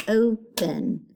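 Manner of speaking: "open" in two beats, o, pen; small gap after the long O in "open"; split syllables. Shown as a demonstration. Teacher showing pupils two syllables, "open" split in two. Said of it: "Two syllables again".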